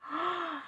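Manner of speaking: sharp shocked intake of breath; gasp. A narrator's reading. Shock after the fall.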